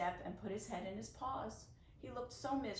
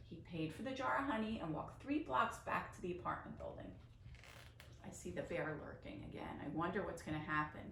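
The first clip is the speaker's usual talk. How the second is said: ordinary delivery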